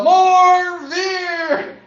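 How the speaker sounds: loud, high rallying cry; imitating crowd-rouser's yell. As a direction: holler it high and long to rile the stands. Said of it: "he would yell".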